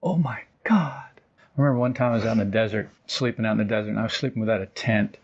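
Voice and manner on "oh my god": a stunned, voiceless whisper of wonder; awed whisper. Retold or imitated. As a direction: breathe it out in hushed awe under the stars. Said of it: "looking up and going".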